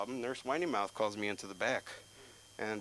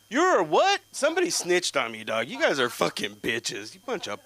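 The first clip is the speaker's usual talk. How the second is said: explosive high shout, dropping in pitch; loud, indignant rant; outraged yelling; 6 semitones higher, 11 dB louder than usual. Acted. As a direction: yell in disbelief at being snitched on. Kid outraged at being caught.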